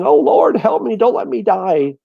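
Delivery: high, loud, hammed-up panic; mock panicked prayer. Imitating a panicking believer praying to the Lord, hammed-up high cry. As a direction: cry out to God in mock terror. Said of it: "instead of panicking and saying".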